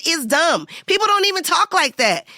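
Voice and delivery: loud-ish, high reenacted protest; blunt objection. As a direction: object indignantly to the script. Retold blunt objection to director.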